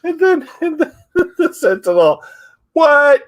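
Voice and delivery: very high, loud excited squeal. Real, not acted. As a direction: squeal it out in giddy disbelief. Excited retelling of the book's climax.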